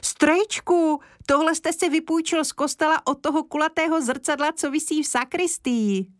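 put-on boy crying out at the shiny gramophone; excited cry. A narrator's reading. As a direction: blurt it out in amazement. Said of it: "Kája vykřikl".

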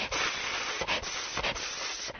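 soft, voiceless snake hissing; excited snake talk, all hiss; snake speech. A narrator's reading. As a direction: hiss in short bursts like a snake. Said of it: "zapomněla překládat z hadí řeči".

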